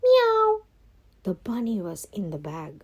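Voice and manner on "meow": high loud meow; cat meow. Acted. Cat catches the rabbit, loud high meow. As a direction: pounce with a sharp meow.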